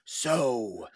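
low, hushed superhero voice; Batman. Pretend. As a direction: carry on chatting in a deep mock-hero voice. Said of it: "No, I can't keep doing it".

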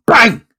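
loud, high mock gunshot; gunshot bang. Telling the bear joke, a loud shouted mock gunshot. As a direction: blurt the bang out like a gun firing.

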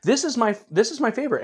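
pitched well up, eager about his favorite; bursting to say it's the best; high, keen fan voice; excited. Real, not acted. Eagerly claims his favorite movie.

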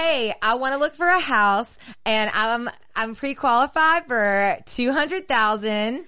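eager, loud client voice; gushing with excitement; excited client. Retold or imitated. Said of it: "they're like".

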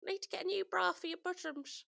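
high-pitched North London granny voice. Retold or imitated. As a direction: imitate a North London grandmother, pitched right up. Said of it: "she was from North London".

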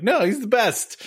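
mock protest, pitched high; imitating co-hosts' objection. Host playfully quoting co-hosts defending Goofy, high mock protest. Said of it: "you guys were like".

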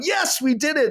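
high, bright imitation of a thrilled engineer; mock excited. Cheering the captain after the rescue, mock excited, high. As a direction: play it like he just pulled it off. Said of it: "And he's, like, excited".

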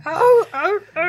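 high barking sea lion calls; sea lion sound. Pretend. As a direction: bark like a sea lion, loud. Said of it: "you said you can do a good sea lion sound".

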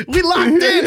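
high, loud laughing exclamation; excited. Real, not acted. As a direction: cheer with delight. Excited shared sneaker memory.

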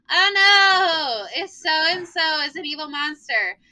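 high, hammed-up pretend alarm; loud "oh no" easing into theatrical dread; mock fright. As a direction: play along with a child's monster game. Teacher playing along, pretending fright.

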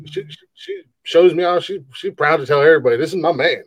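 ordinary delivery

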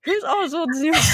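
high, laughing disbelief; squealing with laughter; laughing question. Real, not acted. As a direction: ask through the laughter. GM laughing asking.

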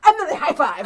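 high, loud burst of glee; laughing punchline. Real, not acted. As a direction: shout the payoff, breaking into laughter.

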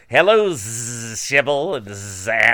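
low, slow cartoon bug voice; buzzy cartoon insect talking slow. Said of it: "you know that insect voice where it's like".

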